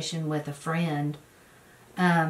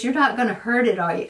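louder, higher fretful question; worried friend; 4 semitones higher, 6 dB louder, faster than usual. Retold or imitated. Friend fretting she will hurt the goat, worried. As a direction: fret over the goat.

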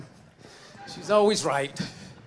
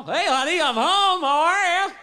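cheery husband; 5 semitones higher, 4 dB louder than usual. Acted. Husband voiced coming home.

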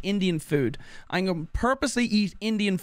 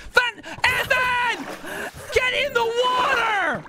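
desperate yell; slower than usual. Real, not acted. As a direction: scream at a teammate to flee. Yelling to teammate in danger.

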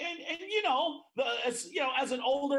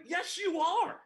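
higher insistent comeback; 10 semitones higher, slower than usual. Retold or imitated. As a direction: flatly insist she is wrong. Retold insistence, high.

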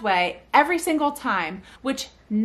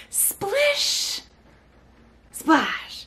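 very sing-songy; 10 semitones higher than usual. Shown as a demonstration. Parent at bath time cues the baby, sing-songy. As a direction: sing it out playfully with a gap between. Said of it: "very sing-songy".

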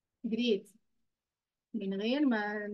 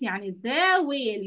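"the" in "the whale" said slowly and a little louder; stress on the; 4 dB louder, much slower than usual. Shown as a demonstration. Teacher reminding pupils to put "the" first, with stress on the. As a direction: lean on "the" so the kids don't forget it. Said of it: "ما ننساش the قبل اسم الحيوان".

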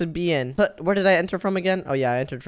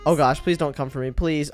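ordinary talk, nothing marked

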